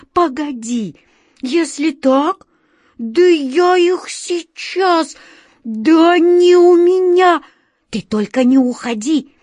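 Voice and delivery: high, loud, drawn-out threats and pleas; desperate shout. A narrator's reading. Wizard promising to deal with the beasts, desperate shout. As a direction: build to a blustering cry at full stretch. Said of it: "отчаянно закричал волшебник Алёша".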